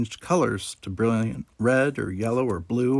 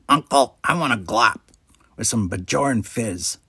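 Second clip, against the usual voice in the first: put-on nasal-free odd Ferengi kid voice; a scheming little alien; sly Ferengi boy. A narrator's reading. Nog suggests eating at his uncle's bar, a sly Ferengi kid voice.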